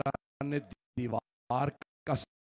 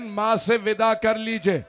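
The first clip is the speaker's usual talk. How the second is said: louder and higher, called across the house; loud grieving call; 8 semitones higher, 10 dB louder than usual. Acted. Father calling his son to bid the mother farewell, loud. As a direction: call out to the son to come take leave. Maula loudly calling Hussain.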